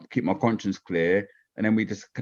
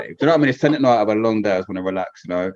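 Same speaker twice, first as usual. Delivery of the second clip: ordinary talk, nothing marked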